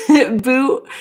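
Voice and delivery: ordinary delivery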